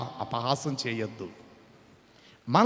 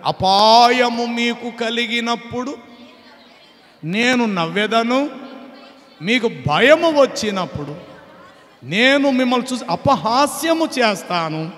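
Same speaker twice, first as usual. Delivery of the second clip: higher, louder, slow scripture proclamation, near a shout; louder and higher, preaching the storm of judgement; preaching at full force; 6 semitones higher, 5 dB louder than usual. Acted. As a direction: thunder out God's warning.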